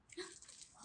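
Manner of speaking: sharp breathy gasp of delight; excited gasp. Acted. Teacher gasps in delight at the present. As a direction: gasp as if seeing a surprise.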